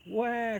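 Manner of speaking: put-on high, wailing baby cry; shrill infant "wah". A narrator's reading. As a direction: wail like a hungry newborn. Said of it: "cried the baby ghost".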